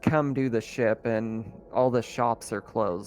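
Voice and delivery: normal talking voice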